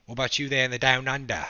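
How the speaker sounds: playful mock Australian question; Australian accent. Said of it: "Is that a good Australian accent?".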